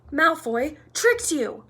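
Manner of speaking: loud, high, slow reproach; scolding. A narrator's reading. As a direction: spell it out accusingly. Hermione scolding Harry.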